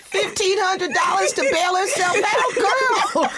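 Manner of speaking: high, squealing words through real laughter; helpless laughter. Real, not acted. Co-host teasing sister about her face, cracking up. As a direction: barely get it out for laughing. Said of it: "I am laughing".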